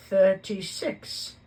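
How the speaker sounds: Dudley counting. A narrator's reading.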